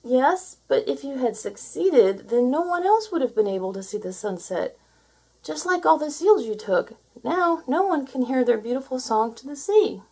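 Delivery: put-on high, earnest young voice; gentle seal.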